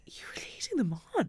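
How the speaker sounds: hushed, breathy whisper of the husband's telling-off. Retold or imitated. Husband quietly tells her she led guys on, in a whisper. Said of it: "my husband was like".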